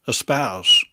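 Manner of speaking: ordinary talk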